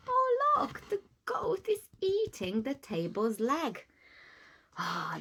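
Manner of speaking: ordinary delivery, nothing marked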